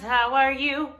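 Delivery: ordinary delivery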